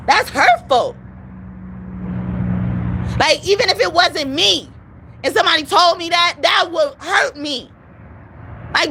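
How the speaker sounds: high, hurt, voice close to breaking; near tears. Real, not acted. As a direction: sound wounded and about to cry. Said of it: "I sounded like I was gonna cry".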